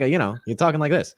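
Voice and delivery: quick, sly cartoon villain; slippery, theatrical bad guy; Hades villain voice. Shown as a demonstration. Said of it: "not a conventional, like, deep villain voice".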